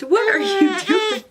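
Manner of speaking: singsong mommy voice. Real, not acted. Talking to her baby.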